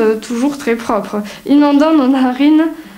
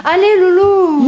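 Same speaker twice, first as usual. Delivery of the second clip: put-on whiny pleading little girl; 7 semitones higher, 3 dB louder than usual. Begging big sister to play.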